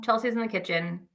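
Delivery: ordinary talk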